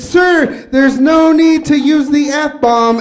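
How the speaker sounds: loud slow prim scolding; loud indignant prude; offended lady. Retold or imitated. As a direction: scold like a prissy offended matron. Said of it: "this lady's all offended".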